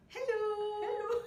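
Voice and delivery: high, loud, drawn-out hello impression; France Lise's hello. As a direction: belt it out in her big theatrical way. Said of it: "as France Lise would say".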